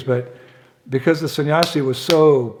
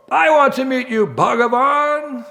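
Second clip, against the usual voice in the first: calling out; 12 semitones higher than usual. Retold or imitated. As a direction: call out, beginning to give up. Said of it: "he just started calling out".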